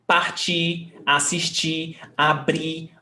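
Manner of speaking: Partir, assistir, abrir, each stressed on its final syllable; last-syllable stress. Shown as a demonstration. Teacher listing infinitives for students, final-syllable stress. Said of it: "the stressed syllable is the last".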